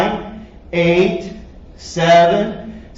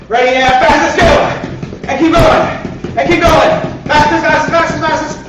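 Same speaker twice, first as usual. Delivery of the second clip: sprint cheer; 8 semitones higher than usual. Real, not acted. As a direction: drive them flat out with every word. Coach pushing sprint.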